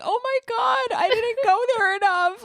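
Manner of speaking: high-pitched wail of regret; retold dismay. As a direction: exclaim it in exaggerated, high dismay. Retells own homesick reaction.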